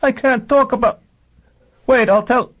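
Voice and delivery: very high, loud screaming plea; terrified scream. A narrator's reading. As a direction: scream it out in panic, far above normal pitch. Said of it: "Aurel screamed".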